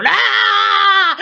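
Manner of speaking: high, startled scream of terror; a sudden panicked shriek; retold scream. Peter screams as Slimer charges.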